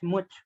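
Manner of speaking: MUCH said "mooch", with a short oo as in book; regional pronunciation. Shown as a demonstration. Student showing teacher a YouTuber's local speech, "much" with oo. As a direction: say much the northern way, with an oo vowel. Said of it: "in his area, he say".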